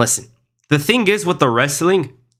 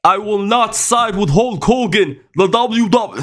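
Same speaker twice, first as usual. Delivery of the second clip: slow, higher-pitched giant impression; Andre the Giant; 6 semitones higher, much slower than usual. Streamer attempting a requested wrestler impression, slow put-on giant voice. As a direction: stretch each word out slowly in an attempted French accent. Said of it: "can you do an impression of Andre the Giant's".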